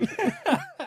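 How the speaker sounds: high, breathless laugh; high wheezing cackle. Real, not acted. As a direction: laugh helplessly at the fall. Burst of laughter.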